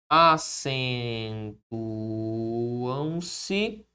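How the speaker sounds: very slow, each syllable stretched; low, long-held syllables, as if dictating to oneself; drawn-out dictation. Real, not acted. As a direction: stretch the word out while writing it. Spelling out while writing.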